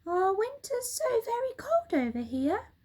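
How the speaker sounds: high squeaky monkey voice; monkey chief, high and eager; Mugglewump. Acted.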